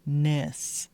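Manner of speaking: nis with jaw dropped; short I. Shown as a demonstration. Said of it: "Drop your jaw".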